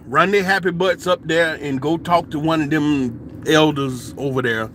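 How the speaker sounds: normal talking voice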